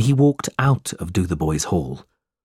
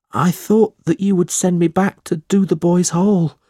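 put-on much higher, louder, begging young voice; a desperate runaway boy clinging to his only friend; runaway boy begging to stay, high and pleading; pleading boy Smike; 8 semitones higher, 3 dB louder than usual.

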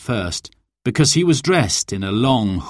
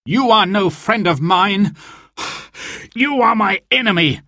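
higher, breathless defiance; gasped; 9 semitones higher than usual. Acted. Robin gasps at Sir Guy as he draws, higher and breathless. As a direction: gasp it out mid-fight. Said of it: "gasped Robin".

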